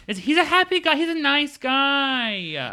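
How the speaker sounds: high, slow, drawn-out gush; sassy phone gushing about Ted Lasso; cooing phone voice. Pretend. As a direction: swoon over the show.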